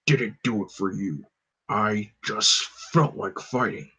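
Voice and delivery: deep, quiet, slow monotone; gruff and unbothered brute; Hulk voice. Acted. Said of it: "deep, monotone version of my voice (Hulk)".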